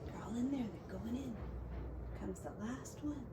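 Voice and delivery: very soft comment; quiet aside. Real, not acted. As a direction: whisper it to the listener. Hushed aside about picture.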